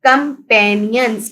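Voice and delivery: Companions slowly sounded out in parts; syllable by syllable. Shown as a demonstration. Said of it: "हम इसको read".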